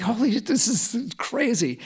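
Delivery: amazed. Retold or imitated. Breakthrough on the yoga mat, awestruck and high. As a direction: gasp it out in sudden wonder. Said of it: "I'm like".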